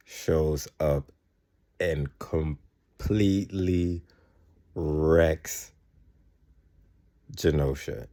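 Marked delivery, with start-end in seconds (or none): 1.8-8.1 slowed right down, each word weighed; slow and heavy for effect; dramatic slow emphasis. Real, not acted. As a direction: drag it out to sell the destruction. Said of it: "And I mean, wrecks it".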